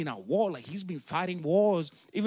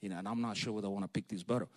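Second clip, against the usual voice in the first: low, soft musing aside; hesitant private thought; 10 semitones lower, 9 dB quieter, faster than usual. Acted. David looking at the giant, hesitant private thought. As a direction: think it aloud, low and quick. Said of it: "looking at that guy and be like".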